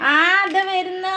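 mother calling out that she is coming, high and loud. Real, not acted. Mother calls to child.

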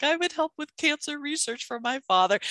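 a hint of a sweet little girl on TV; imitating little girl. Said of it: "she's like".

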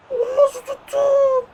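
high, slow wailing disbelief; anguished disbelief. Real, not acted. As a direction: cry out as if the save hurt you. Rakip kurtarışına şaşkın çığlık. (yüksek, yavaş, inleyen bir şaşkınlık)

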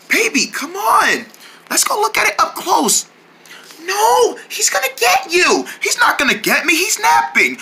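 put-on high, nervous child; little kid voice. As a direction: play a timid small kid.

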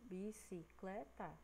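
bicicleta slowly split bi-ci-cle-ta; syllable by syllable. Shown as a demonstration. Teacher counting four syllables, bicicleta slowly segmented. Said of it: "quatro sílabas".

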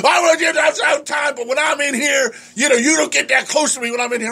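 high, ranting mock shout; imitating a drunk yelling. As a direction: rant at him like the angry drunk. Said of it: "He goes".

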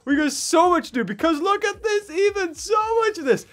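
excited. Real, not acted. Excited showing off the game.

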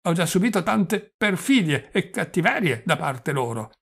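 put-on doddering old prince.

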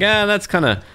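high mock voice of picky viewers; mocking commenters. Imitating viewers who found his accent goofy, high mocking voice. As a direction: lift the voice up high and whine it out like a critic. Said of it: "a few people have been like".

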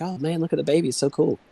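excited parents at the ultrasound, lightly acted; retold excitement. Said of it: "We were excited 'cause we was like".

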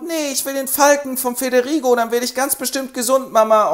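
put-on high whiny boy voice; child voice. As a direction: demand it like a spoiled kid. Son demands falcon.

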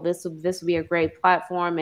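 usual speaking voice

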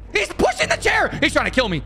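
panicked. Real, not acted. Panicked while chased.

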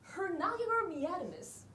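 Japanese said with a mock English lilt; mock English accent; English-sounding. Shown as a demonstration. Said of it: "英語っぽく言って".

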